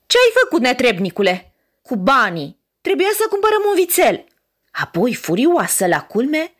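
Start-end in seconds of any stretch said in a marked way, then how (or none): 0.1-4.2 a mother berating her son; harsh scolding. Acted. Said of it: "mama îl certă cu asprime". (mamă care își ceartă aspru fiul)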